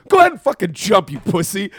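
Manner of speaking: high, loud mock-furious taunt; berating yell. Maria tells her fiance to jump, yelling. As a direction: yell it as a scornful dare. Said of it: "just starts yelling at him".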